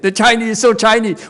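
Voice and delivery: normal talking voice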